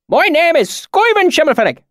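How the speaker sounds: high, loud thick rural dialect; thick deep valley accent. A narrator's reading. Swineherd greets strangers in thick valley accent. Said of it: "his accent so thick".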